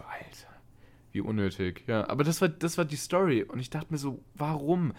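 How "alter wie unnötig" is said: breathy whisper to yourself; whispered thought. Retold or imitated. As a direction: whisper it under your breath.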